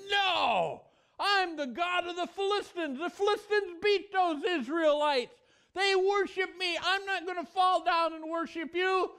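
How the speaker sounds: boastful false god refusing to bow; proud Dagon. Acted. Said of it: "proud Dagon over there, he said".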